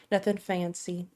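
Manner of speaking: usual speaking voice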